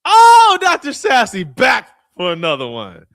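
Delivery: loud, high hype shout; excited shout. Real, not acted. Host hyping a returning speaker, excited shout. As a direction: shout the name out like a hype man.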